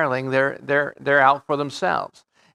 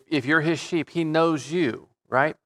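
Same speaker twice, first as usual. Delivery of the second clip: usual speaking voice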